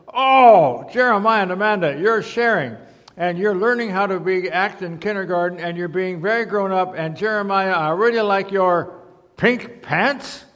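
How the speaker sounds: gushing storybook teacher; kindergarten teacher, praising. A narrator's reading. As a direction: play a teacher delighted by good behaviour.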